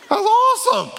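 very high, loud fake cheer; hammed-up congratulations; mock amazement. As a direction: squeal it with sarcastic delight. Mock praise God would never give.

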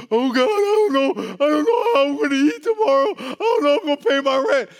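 panicked praying about rent; anxious crying prayer. Acted. A broke believer begs God for rent money, crying.

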